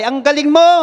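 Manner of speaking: loud, high cheerful shout; father shouting. Acted. Father shouting encouragement to the mountains. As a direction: call praise across the valley. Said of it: "Sumigaw yung tatay".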